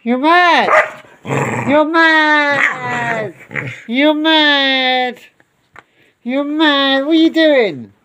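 high, sing-song scolding of the dog; high dog-talk voice. Real, not acted. Owner mock-scolding the dog over the blanket, high pet voice.

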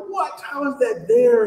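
high-pitched freaked-out disbelief; freaking out. Retold or imitated. Author retelling his find of an error, freaking out. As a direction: recreate a panicked outburst, pitch leaping up. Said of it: "I'm freaking out".

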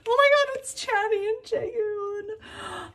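very high, slow, choked-up cry of joy; almost cry over the bias card; finding her bias's card, emotional squeal; near-tearful fangirl squeal. Real, not acted. Finds her bias's photocard.

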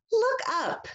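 excited call. Shown as a demonstration. Echo read, second take.

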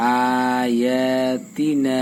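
আয়াতিনা drawn out slowly on first, second and last vowels, তা quick; madd lengthening. Shown as a demonstration. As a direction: stretch আ, য়া and না but clip তা in আয়াতিনা. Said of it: "প্রথমটা আর দ্বিতীয়টা এবং শেষেরটায় টান". (আয়াতিনা টেনে, তা তাড়াতাড়ি)

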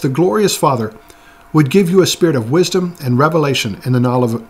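ordinary delivery

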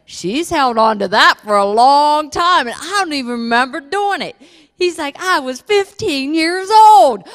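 high, loud, laughing disbelief; a high, loud laugh breaking into the quote; laughing retelling. Retold or imitated. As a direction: laugh through the words at the memory. Retelling minister's laughing story.

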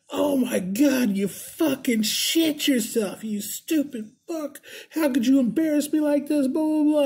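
high-pitched imitation of an outraged girlfriend; girl going off. Girl berating the man who embarrassed her, going off. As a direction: wail it high and drawn out, scolding him. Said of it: "This girl's going off".

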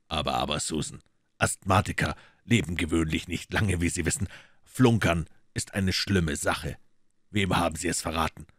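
cool detective teasing the maid; dry detective. Acted.

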